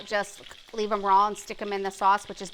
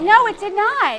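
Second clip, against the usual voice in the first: high, loud disbelieving cry; aghast at the story; real shock; shocked protest; 10 semitones higher, 9 dB louder, slower than usual. Real, not acted. Shocked at swastika.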